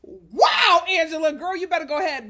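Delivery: loud, high shout of disbelief; loud excited shout. Real, not acted. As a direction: holler it in disbelief. Reacting to surgery scene.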